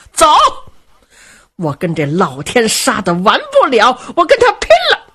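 put-on fly into a rage as the old mother; angry old lady. Old lady vowing to fight her husband, furious. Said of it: "老太太一听还不生气吗".

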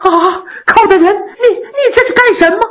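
high, startled stammer; flustered, high-pitched shock; frightened princess. A narrator's reading. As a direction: protest in alarm, voice leaping up. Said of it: "可把郡主吓坏了".

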